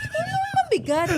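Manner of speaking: high, laughing, incredulous question; mock disbelief. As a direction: pitch it way up in comic bafflement. Mock disbelief at heavy scene.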